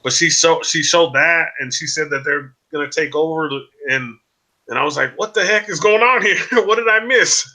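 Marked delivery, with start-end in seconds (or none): 5.2-7.5 higher-pitched, mock-baffled reaction with a laugh; amused, exaggerated confusion; retold bafflement. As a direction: replay your disbelief, pitched up. Retells baffled reaction to video.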